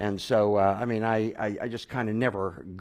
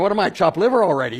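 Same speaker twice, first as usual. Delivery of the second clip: higher, louder and fast comic grievance; mock indignant; 10 semitones higher, 10 dB louder, faster than usual. Ignored while the stranger marvels, fast mock indignation.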